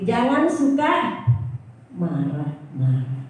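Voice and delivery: preacher driving the refrain home to the congregation, slow and emphatic. Shown as a demonstration. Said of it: "Yuk, kita semua ikuti".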